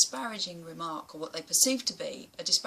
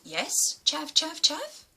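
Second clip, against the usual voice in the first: high, rising sing-song jeer; taunting a bully at a bus stop, high mocking chant; mocking taunt back; 8 semitones higher, 5 dB louder, slower than usual. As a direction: throw the insult back at him, higher each time. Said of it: "stood in front of him and went".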